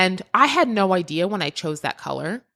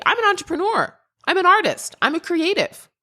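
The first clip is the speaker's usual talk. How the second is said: much higher, proud and bright listing; proud declaration; 11 semitones higher than usual. Real, not acted. Host tells listeners what she is, proud and higher. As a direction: announce who you are with pride.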